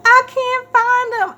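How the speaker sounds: high, slow, breaking voice; tearful confession. Retold or imitated. Mom admitting to her daughter the cat is lost, high and tearful. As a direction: wail it out as if close to tears. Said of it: "I was like".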